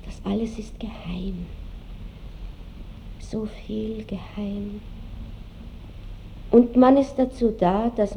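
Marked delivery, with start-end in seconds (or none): 0.0-4.8 half-whispered, slowing down; hushed awe. Acted. Marschallin musing alone on what is secret, hushed. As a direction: let it sink to a soft, secretive murmur.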